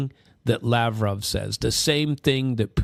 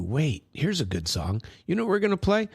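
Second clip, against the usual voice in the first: ordinary delivery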